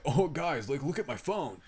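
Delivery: normal talking voice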